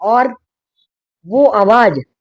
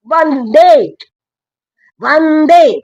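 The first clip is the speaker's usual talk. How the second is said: loud, high rallying cry; slogan shout; 9 semitones higher, 6 dB louder, much slower than usual. Real, not acted. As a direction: call out to the crowd, full force.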